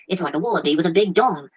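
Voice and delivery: usual speaking voice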